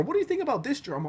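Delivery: high, fast gossipy question; chatty friend voice. Retold or imitated. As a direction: fire it off like an eager friend. Voicing a friend asking quickly.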